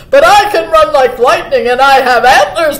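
loud, proud buck; boasting. A narrator's reading. Buck out-boasting the cardinal, loudly bragging. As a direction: brag at full voice. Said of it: "boasted the buck".

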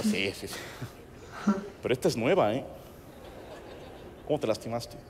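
a hint of low, soft gruff talk; imitating Alfredo. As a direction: mumble it like the masseur. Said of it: "el vato". (imitación suave y grave)

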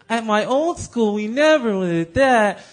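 high, loud, slow schoolgirl impression; Dorothy Ann. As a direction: draw it out as a know-it-all cartoon pupil. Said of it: "Dorothy Ann would be like".

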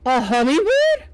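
loud, appalled disbelief; shocked question. Acted. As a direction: gasp it out in horror. Shocked reveal of the hat.

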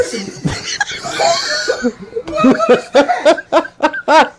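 high laughter. Real, not acted. Laughing at the milk video.

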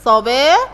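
"صباح" with a drawn-out middle and an -in ending; teacher sounding out the kasr tanween on "صباح"; tanween demonstration. Shown as a demonstration. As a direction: say "صباح" as sabaa-hin, stretched and rising. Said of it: "هستخرج تنوينا أو كلمة بها تنوين".

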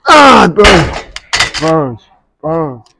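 slow, loud strained groan; straining in pain. Real, not acted. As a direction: groan through the burn. Muscles burning mid-set.

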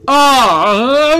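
loud, high wavering cry; frustrated scream. Real, not acted. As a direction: let out a cry of anger. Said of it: "I'm so ang".

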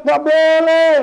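sharp, loud, high nggak boleh; emphatic forbid. Real, not acted. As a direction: forbid it firmly. Preacher forbidding in-laws. (larangan tegas dan lantang)

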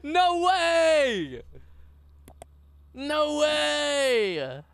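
loud excited disbelief; excited shout. Real, not acted. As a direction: yell it out in disbelief. Excited reaction to virus.

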